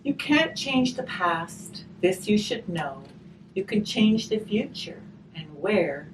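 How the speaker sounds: gentle storybook reading, slow on the rhymes; reading to children. Acted. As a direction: read the rhyme aloud to the children. Reading a rhymed picture book aloud.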